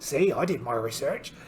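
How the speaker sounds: ordinary delivery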